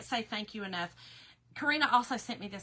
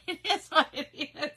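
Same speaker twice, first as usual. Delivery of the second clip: laughing; 5 semitones higher, 6 dB louder than usual. Real, not acted. Laughing after joke.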